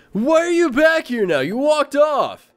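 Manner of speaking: high, loud, incredulous protest; exasperated scolding. Real, not acted. Streamer scolds a game character for walking off, loud and exasperated.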